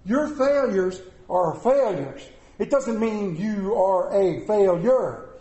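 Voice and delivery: slow, weighted preaching with each clause landing hard; emphatic preaching. Real, not acted. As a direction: drive home every phrase to lift them up. Preaching encouragement at full force.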